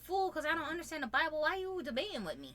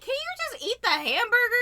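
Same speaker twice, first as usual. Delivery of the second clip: loud, high mock outburst; imitated aggravated wife; 9 semitones higher, 9 dB louder than usual. Wife to over-analyzing husband, mock aggravated. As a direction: raise it in fed-up exasperation, acted. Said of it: "She will get so aggravated".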